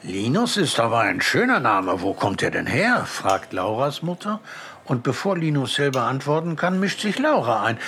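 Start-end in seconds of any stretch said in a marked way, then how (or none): none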